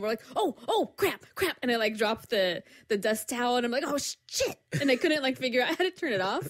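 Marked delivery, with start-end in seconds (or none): 0.4-1.6 mock panic. Panicking alone with noisy toy. 3.8-4.6 mock panic. Panicking over lightsaber toy.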